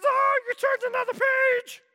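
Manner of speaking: a startled monster caught out; Grover dismayed. Retold or imitated. Grover scolding the reader for turning the page, high alarm. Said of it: "But he says".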